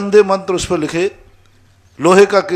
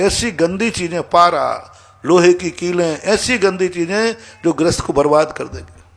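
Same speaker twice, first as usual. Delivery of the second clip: ordinary talk, nothing marked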